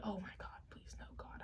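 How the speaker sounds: breathy whispered plea, very soft; whispered prayer. Retold or imitated. Scared friend whispering a prayer for help, hushed whisper. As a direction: beg God under your breath. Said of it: "I was really praying".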